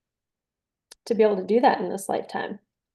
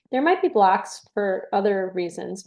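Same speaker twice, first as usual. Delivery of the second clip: ordinary talk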